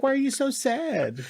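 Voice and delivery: very high mock cooing; high sugary mock motherly voice; cooing mom. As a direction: coo it like a sweet old mom. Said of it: "She's like".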